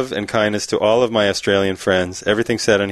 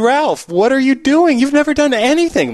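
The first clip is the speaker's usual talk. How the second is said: high, scandalized dog owner; mock-shocked scolding; 3 dB louder than usual. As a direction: confront the dog in hammed-up disbelief. Shocked owner confronts dog in joke.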